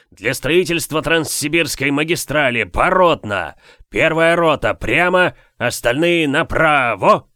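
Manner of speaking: loud barked marching orders; shouting drill sergeant. Acted. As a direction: bellow commands at the troops. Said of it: "князь зычно кричит".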